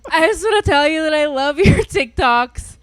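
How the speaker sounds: put-on loud, high drunken gushing; drunk fan. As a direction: imitate a tipsy fan yelling her love. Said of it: "she was drunk".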